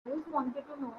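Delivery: very faint, far from the mic; low volume, hard to hear; barely audible. Real, not acted. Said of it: "your voice is, is very low".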